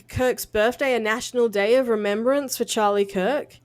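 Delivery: ordinary talk, nothing marked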